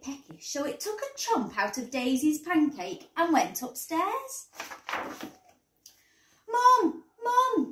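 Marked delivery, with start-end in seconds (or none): 6.5-7.7 loud, high-pitched call for Mum. A narrator's reading. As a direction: voice a child urgently calling out. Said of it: "called Daisy".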